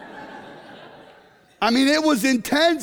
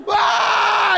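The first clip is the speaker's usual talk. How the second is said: long, high comic yell of pain; imitated pain scream; 10 semitones higher, 4 dB louder than usual. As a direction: scream as if your knuckle just popped. Imitates his scream as knuckle dislocates.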